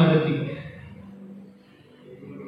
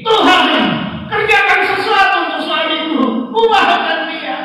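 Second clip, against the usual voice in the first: much higher, loud urgent plea; five-second prayer; 5 dB louder than usual. Shown as a demonstration. Preacher demonstrating the aunt's five-second prayer, high and loud. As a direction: fire off a short high desperate prayer. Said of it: "seperti tanteku ini".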